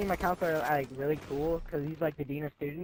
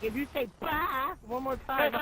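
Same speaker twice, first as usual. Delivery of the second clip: higher mocking teacher voice, bleating like a sheep; silly teacher imitation; 7 semitones higher than usual. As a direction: make the teacher sound like a sheep.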